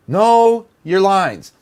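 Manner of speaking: KNOW stretched out, high and slow; emphatic insistence. Real, not acted. Director laying down a rule for actors, emphatically. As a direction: hammer KNOW, drawing it long.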